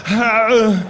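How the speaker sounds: long answering mock grunt; drawn-out put-on howl; Arnold Schwarzenegger. Star answering a fan's call, mock groaning. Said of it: "he went just like this".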